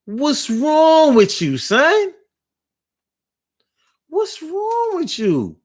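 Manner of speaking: a light take on the chicks asking what's wrong; lightly voiced girls in the group; imitating the girls. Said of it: "they was like".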